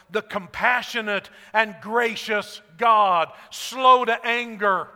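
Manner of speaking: slow, loud scripture proclamation; slow proclaiming. Shown as a demonstration. Preacher proclaims God's name to the congregation, slow and grand. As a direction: read it out slowly and grandly.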